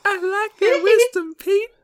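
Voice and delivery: very high, loud falsetto squeal; silly falsetto character. Pretend. Co-host teasing Pete about his wisdom, silly falsetto.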